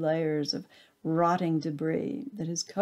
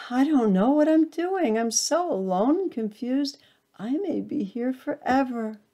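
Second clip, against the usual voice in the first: lost and frightened; 6 semitones higher than usual. A narrator's reading. Acorn lost in darkness.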